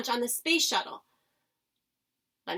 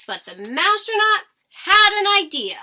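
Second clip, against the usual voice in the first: loud, higher announcement of the idea; excited reveal; 8 semitones higher, 12 dB louder than usual. Acted. Narrator revealing the mouse's plan, loud and excited. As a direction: burst in with sudden excitement. Turning point in story.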